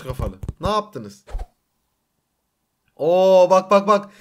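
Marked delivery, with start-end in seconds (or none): 3.0-4.1 loud, eager pointing out; eager loud look-look-look; excited. Real, not acted. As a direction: call attention excitedly. Sees lighting effects.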